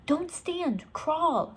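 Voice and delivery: high, urgent command; high-pitched urgent order. A narrator's reading. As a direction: stop her quickly. Urgent instruction near dinosaur.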